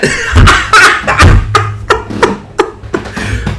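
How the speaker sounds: breathless high laugh; real wheezy laugh; airy high-pitched cackle; high wheezing laugh. Real, not acted. Laughing at own story.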